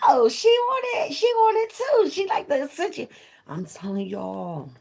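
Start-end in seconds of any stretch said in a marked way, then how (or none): 0.1-3.1 high, excited squeal; thrilled to find a fellow fan. Retold or imitated. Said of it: "I'm like".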